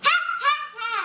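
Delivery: high mocking laugh, a real chuckle in it; mocking laughter. Tree animals jeering at Toad's kite, high laugh. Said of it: "The forest animals in the tree laughed again".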